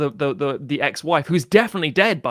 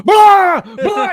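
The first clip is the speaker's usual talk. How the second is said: very high, loud shouted blahs; 13 dB louder than usual. Retold or imitated. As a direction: yell blah at the top of your voice. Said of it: "And he goes".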